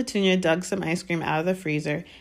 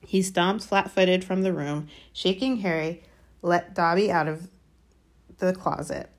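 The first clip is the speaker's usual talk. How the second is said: ordinary delivery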